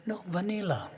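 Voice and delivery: lightly voiced sweet, gentle correction; a hint of a sweet waitress. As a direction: sweetly insist there is nothing else. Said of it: "she spoke in a sweet voice".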